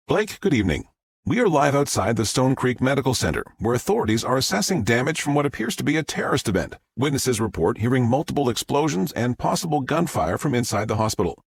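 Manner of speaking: upbeat live news report voice; TV reporter. Acted. Reporter Fox addresses the camera in a live news voice. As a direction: deliver it like a reporter live on air.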